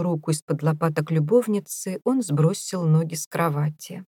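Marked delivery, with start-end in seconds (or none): none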